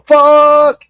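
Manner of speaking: high, loud burst of a curse; sudden demonstration shout; sudden yell. Shown as a demonstration. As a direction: yell it out of nowhere, full voice. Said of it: "all of a sudden yell".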